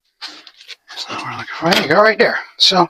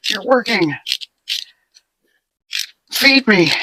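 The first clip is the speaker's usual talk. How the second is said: voicing the rabbit; 8 semitones higher than usual. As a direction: speak for the hungry bunny, pitched up. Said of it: "He's like".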